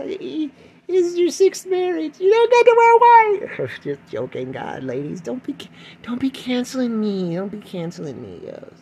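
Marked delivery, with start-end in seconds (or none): 0.0-3.7 very high, slow, louder mock scold; mock scolding shout. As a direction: scold her shrilly and slowly. Retort to six-times bride.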